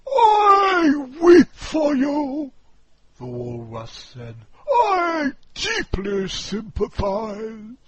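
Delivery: high, loud, slow hammed-up weeping walrus; walrus mock weeping. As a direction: fake sorrow, overplayed.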